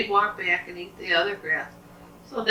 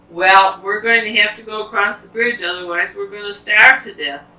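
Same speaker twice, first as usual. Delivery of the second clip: lightly voiced goats, louder and higher; hungry goats; 4 semitones higher, 9 dB louder than usual. Hungry goats decide to cross the bridge, mildly emphatic. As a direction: declare it with a touch of alarm.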